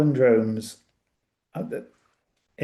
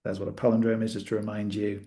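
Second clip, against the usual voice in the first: ordinary talk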